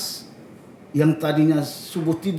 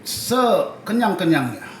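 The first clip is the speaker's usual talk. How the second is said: stretched word; 3 semitones higher, slower than usual. Shown as a demonstration. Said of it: "kita tarik".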